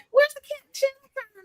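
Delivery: high-voiced imitation of a puzzled question; imitating cousins. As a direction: mimic the cousins in a high, put-on voice. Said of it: "My cousins, I remember when they moved here from California".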